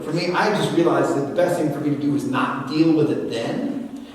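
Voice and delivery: ordinary talk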